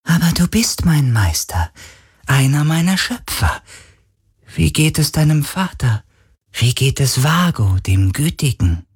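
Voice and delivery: high, breathy spirit voice; eager ancient spirit asking after old friends; ghostly spirit. A narrator's reading. Ghost asks Carlin about his father Vago, high spirit voice.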